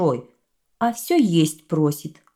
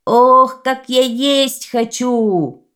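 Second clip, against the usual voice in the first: slow, loud, drawn-out howl; louder bellow; 7 semitones higher, 7 dB louder, much slower than usual. Acted. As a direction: roar it out even hungrier. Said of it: "кричит громче".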